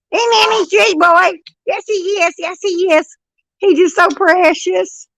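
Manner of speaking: high, slow, doting coo. Real, not acted. Nanny cooing over her sweet baby grandson in baby talk.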